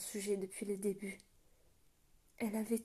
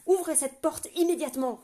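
loud, high commanding roar; 6 semitones higher, 9 dB louder than usual. A narrator's reading. Zilla ordering the guard to open the gate, roaring. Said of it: "rugit Zilla".

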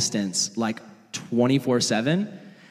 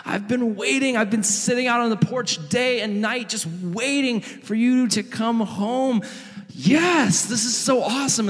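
overjoyed, tearful father; 9 semitones higher than usual. Acted. Dad welcomes his lost son home, overjoyed. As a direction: play a dad overcome with relief. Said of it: "he's got tears streaming down his face".

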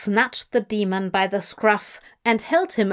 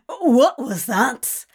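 slow, spluttering after coughing; 3 semitones higher, 3 dB louder, slower than usual. A narrator's reading. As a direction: ask through a cough. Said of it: "Crowley coughed".

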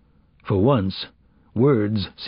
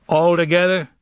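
ALL TOGETHER pushed higher, then falling; insistent repeat; 9 semitones higher, 4 dB louder than usual. Acted. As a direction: demand agreement, higher in pitch. Agent repeats question, demanding agreement.

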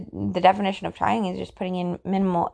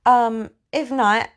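ordinary talk, nothing marked

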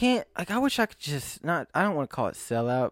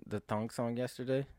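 ordinary delivery

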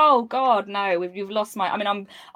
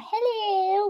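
high, exaggerated Liverpudlian hello; sing-song Scouse children's character greeting; Liverpudlian Poppy; 10 semitones higher than usual. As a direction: squeal it like Poppy in a Liverpool accent. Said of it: "that Poppy from Playdays".

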